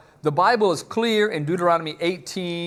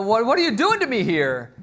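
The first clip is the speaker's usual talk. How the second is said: higher-pitched, stammered alarm; acted panic; 6 semitones higher than usual. Medium confronting Saul after seeing Samuel, acted panic. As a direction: play someone panicking and demanding answers. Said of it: "see the panic in her voice".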